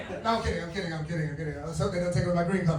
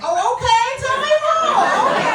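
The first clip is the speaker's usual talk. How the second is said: high, loud, suddenly thrilled; mock gushing interest, pitched far up; imitating charmed listeners; 9 dB louder than usual. Americans hearing the accent, imitated as loudly charmed and eager.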